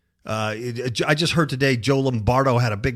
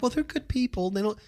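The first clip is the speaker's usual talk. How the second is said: mock excuse-maker; 7 semitones higher, 4 dB quieter than usual. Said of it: "There's no, like".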